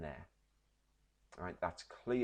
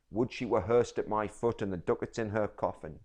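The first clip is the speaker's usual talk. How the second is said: louder, raging at the dead daughter; loud bitter wish; 8 dB louder than usual. Shown as a demonstration. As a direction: spit the curse out. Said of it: "So let's read it again".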